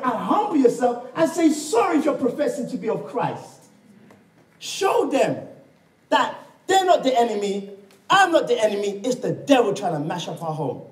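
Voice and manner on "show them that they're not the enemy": normal talking voice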